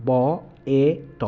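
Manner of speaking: বেট split into ব, এ, ট; sounded out. Shown as a demonstration. Teacher splitting the word for students, sounded out. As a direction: sound out ব ... এ ... ট one by one. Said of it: "ভাগ করে বলার চেষ্টা করি".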